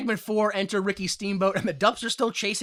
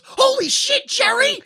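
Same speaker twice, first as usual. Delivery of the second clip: shocked, disappointed scream, very high and loud; Goldilocks; 9 dB louder than usual. Retold or imitated. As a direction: shriek it in shocked dismay. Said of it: "shocked but disappointed scream where she goes".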